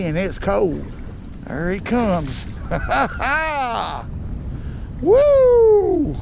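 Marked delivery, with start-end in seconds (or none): 2.5-5.0 excited laugh. Real, not acted. Fish landed, excited laugh. 5.0-6.2 high loud whoop of joy; excited whoop. Real, not acted. Celebrating catching big bass.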